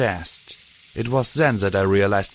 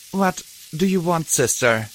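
begging; 7 semitones higher than usual. Acted. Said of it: "Glory begged".

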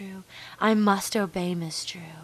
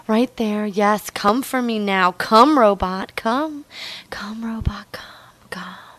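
louder, insistent bursts over breathy murmurs; building urgent command; 6 dB louder than usual. Pretend. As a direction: drive the commands to a peak, then ease off. Roleplay builds to its climax.

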